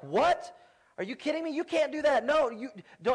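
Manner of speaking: high, disbelieving outburst; shocked son. Retold or imitated. Son on the phone hearing his parents will divorce, shocked. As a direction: protest in stunned disbelief.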